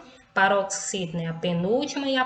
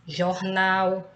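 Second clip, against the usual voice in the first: "jornal" with weight on the last syllable NAL; stress on final syllable. Shown as a demonstration. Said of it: "sílaba nal com mais intensidade".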